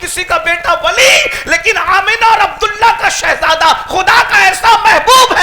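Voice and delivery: high, loud, building sermon cry; fiery preaching. Acted. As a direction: preach it to the rafters, rising each line.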